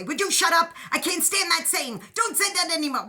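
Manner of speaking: loud, high mock scolding; a hammed-up fed-up rant; pretend telling off. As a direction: snap at an imagined person in pretend anger. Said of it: "go up to that person and say".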